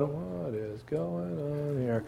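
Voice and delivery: slow, hushed and baffled; Mary stunned by the angel, hushed and slow; bewildered hush. Acted. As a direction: mutter it in bewilderment. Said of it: "And she's going".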